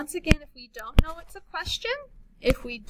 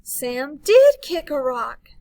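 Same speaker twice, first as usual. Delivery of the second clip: louder, slow, punching did; excited statement; 9 dB louder, slower than usual. Shown as a demonstration. Teacher contrasting question and exclamation, excited. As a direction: say it with strong emotion. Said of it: "But if Sam is first, I'm excited".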